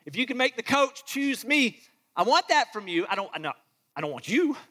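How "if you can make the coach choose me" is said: higher, pleading mock prayer for a favour; higher whiny mock plea; shallow mock prayer. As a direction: mimic a selfish petitioner begging.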